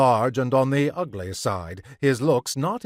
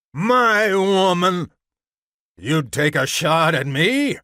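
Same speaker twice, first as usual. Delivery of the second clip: loud, higher, outraged man; enraged growl; 7 semitones higher, 6 dB louder than usual. A narrator's reading. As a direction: roar in disbelief, stretching the first words. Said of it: "the man's voice growled".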